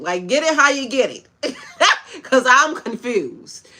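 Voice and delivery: laughing through the words; amused chuckle into a high laugh; can't hold back the laugh. Real, not acted. Laughs at own remark.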